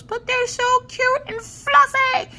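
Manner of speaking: put-on very high, drawn-out little-girl squeal; fluffy-unicorn little girl. Little girl gushing to zookeeper over bears, high-pitched squeal. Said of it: "She reminds me of the little girl from".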